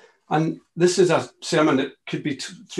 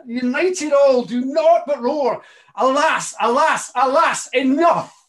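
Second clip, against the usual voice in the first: higher and louder, crying out alas; roaring climax; 8 semitones higher, 5 dB louder, slower than usual. Acted. Angels roaring alas at the poem's peak, loud and high. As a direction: build to a roaring lament.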